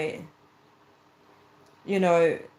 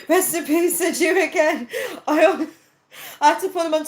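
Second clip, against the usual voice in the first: high-pitched, real cracking up; laughing; 8 semitones higher than usual. Real, not acted. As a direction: break up laughing while reading it out. Cracks up reading viewer comments.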